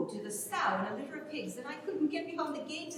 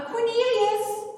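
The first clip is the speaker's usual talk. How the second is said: calling out; 8 semitones higher, 8 dB louder, slower than usual. Acted. A woman calls Cornelius to come help, loud call. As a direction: call the name out loud across the yard. Calling for him across house.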